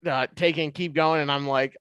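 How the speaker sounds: ordinary talk, nothing marked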